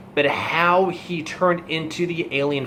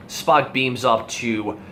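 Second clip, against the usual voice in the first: ordinary talk, nothing marked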